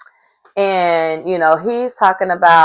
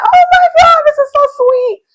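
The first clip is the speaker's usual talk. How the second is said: very high squeal, louder; squealing delight; 7 dB louder than usual. Retold or imitated. Vlogger gushing over the couple, squealing very high. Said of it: "I'm like".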